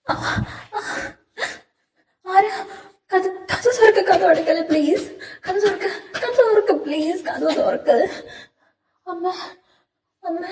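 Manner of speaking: bang on the door and beg through panic; panicked begging. Acted. Locked in the dark, he begs his mother to open the door in terror.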